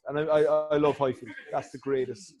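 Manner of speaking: usual speaking voice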